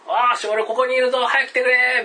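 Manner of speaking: high, loud yell for help; lost man yelling to rescuers; desperate shout. Retold or imitated. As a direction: shout with all you have to be found. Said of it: "みたいなこと言って".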